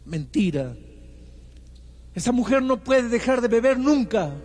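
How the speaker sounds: indignant husband raising his voice; husband's harsh retort. Retold or imitated. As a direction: throw the accusation back hard. Voicing the husband's harsh refusal.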